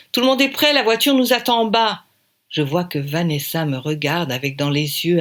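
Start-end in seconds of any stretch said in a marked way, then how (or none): 0.1-2.0 high, loud urgent call; hurried loud call. Acted. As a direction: round everyone up to leave. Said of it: "crie Audrey".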